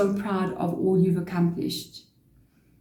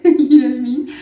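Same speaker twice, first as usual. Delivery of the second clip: laughing; 5 semitones higher, 9 dB louder than usual. Real, not acted. Laughs at spirit's reaction.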